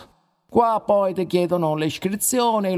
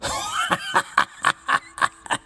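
shrieking cackle. Acted. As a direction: burst into a mad cackle. Risata dopo la risposta. (risata stridula e beffarda)

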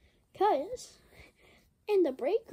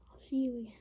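soft, breathy goodbye under the breath; whispering goodbye to Pikachu; whispered farewell; 5 semitones lower, 6 dB quieter, much faster than usual. Acted. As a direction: whisper it sadly.

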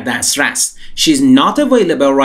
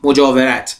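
ordinary delivery

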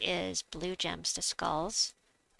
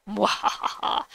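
slow, louder evil cackle; mad scientist; 2 semitones higher, 6 dB louder, slower than usual. Pretend. Villain laugh at skull combo.

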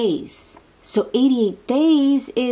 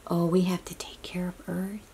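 softer whispered message, a bit quick; earnest whisper; 3 semitones lower, 9 dB quieter, faster than usual. Acted. Telling a child to care for Earth, earnest whisper. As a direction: whisper it like an important secret.

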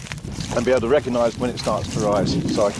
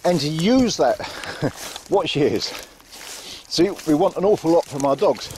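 ordinary delivery

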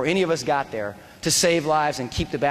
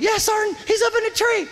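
retold yelling. Soldier yelling to his sergeant about a shooter, high urgent shout. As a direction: yell it high over gunfire. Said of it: "Floyd's like".

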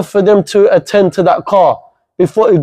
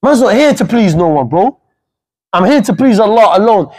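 ordinary talk

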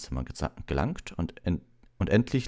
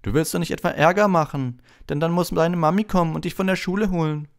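high, loud taunt; mocking high-pitched sneer; jeering Malfoy; 14 semitones higher, 7 dB louder than usual. A narrator's reading. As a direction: needle him like a schoolyard bully. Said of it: "höhnte Malfoy".

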